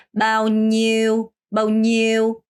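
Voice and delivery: "마우뉴" drawn out slowly; teacher's slow model "마우뉴"; model pronunciation. Shown as a demonstration. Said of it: "마우뉴 이거라고 하는데요".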